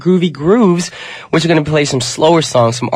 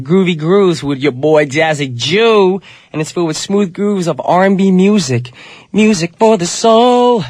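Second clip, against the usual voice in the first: smooth radio announcer; 4 semitones higher than usual. Acted. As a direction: croon it like a late-night DJ. Radio segment announcer intro.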